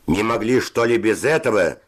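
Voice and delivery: high, exasperated shout; high-pitched scolding cry. A narrator's reading. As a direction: raise the voice in annoyed reproach. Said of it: "закричал Севочка".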